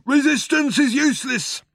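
high, loud bellowed slogan; Vogon guard's shout. A narrator's reading. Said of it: "shouted the Vogon guard back at him".

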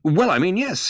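nervous. A narrator's reading. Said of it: "Ford laughed a nervous laugh".

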